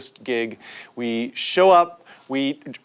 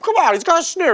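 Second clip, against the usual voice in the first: high-pitched protest; mock pleading; 13 semitones higher, faster than usual. Band begs the club guy for the snare, mock pleading.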